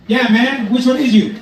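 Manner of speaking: high, loud, pushy challenge. Acted. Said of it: "And that guy says".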